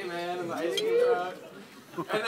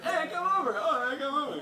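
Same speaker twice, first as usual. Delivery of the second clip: mock calling out; 10 semitones higher, 3 dB louder than usual. Yelling between bedroom windows.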